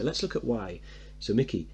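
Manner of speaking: ordinary talk, nothing marked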